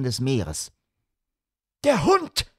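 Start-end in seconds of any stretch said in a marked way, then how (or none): 1.8-2.5 high alarmed cry; shout. A narrator's reading. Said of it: "schrie Holmes". (hoher, alarmierter Schrei)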